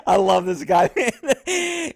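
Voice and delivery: talking through laughter; laughing as he talks. Real, not acted. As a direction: say it while cracking up. Laughing at the officer.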